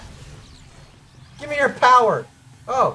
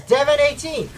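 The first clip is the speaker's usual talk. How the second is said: normal talking voice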